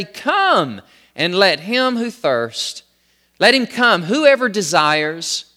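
high, slow, forceful proclamation; emphatic scripture preaching. Acted. Preacher voicing God's plea to the house of Israel, emphatic.

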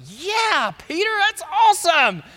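high, loud, goofy cheer; mock-excited buddies praising Peter; cheering disciples. Disciples cheering Peter's answer, high goofy excitement.

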